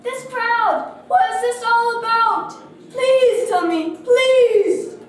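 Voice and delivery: high, loud, urgent plea; crying out. A narrator's reading. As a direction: beg loudly to be told. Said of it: "he cried".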